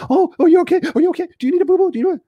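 very high, frantic fussing; mock helicopter parent. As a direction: fuss over a child in an exaggerated squeal. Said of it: "their parents are like helicopters".

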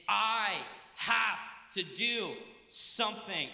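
slow, insistent stress on "have"; a determined "I have to do something", leaning on "have". Shown as a demonstration.

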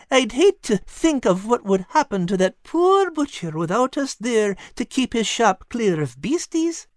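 hungry cat begging to stay; pleading cat. Acted. Al the cat voiced.

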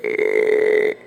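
long, low drawn-out creak; low creaking "ehhh"; creaky door noise. Retold or imitated. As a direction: imitate a slowly creaking door. Imitates the creaking bathroom door.

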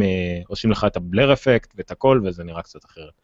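ordinary talk

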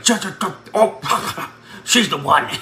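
high, stuttering, laughing amazement; stammering awe. Retold or imitated. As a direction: stammer like you just saw the one. Sees her, stammers in awe.

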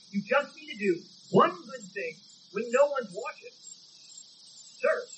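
preaching. Acted. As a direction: hammer each "You don't need" home.

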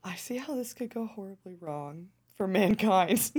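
normal talking voice

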